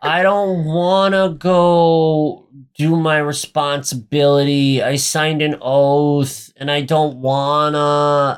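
slow, drawn-out mock whine; imitating a big baby's whinging; whiny baby. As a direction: drag out each complaint like a sulky child. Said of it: "such a baby".

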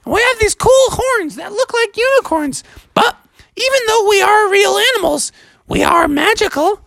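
squeaky, very high sea-creature voice; friendly magical creatures in high falsetto; narwhal chorus. Acted. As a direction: reassure him in a tiny falsetto. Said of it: "And they're like".

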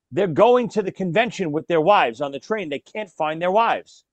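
usual speaking voice

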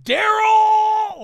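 long, high, very loud name yell; exaggerated drawn-out sibling scream; yelling at brother. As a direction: holler after your brother in mock fury. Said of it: "you're yelling at, like, your fucking brother".

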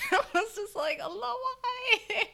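high laughing Allah why; high-pitched laughing exasperation; laughing plea. Real, not acted. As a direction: laugh through a despairing plea.

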